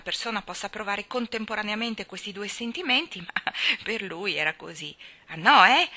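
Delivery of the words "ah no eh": loud, higher indignant comeback; challenging. A narrator's reading. Colin challenges Mary's disbelief, defiant. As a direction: dare her to deny it. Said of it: "la sfidò".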